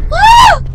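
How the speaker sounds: short, very high scream; sudden high shriek of fright; startled scream. Real, not acted. Player jumped at while running, startled scream.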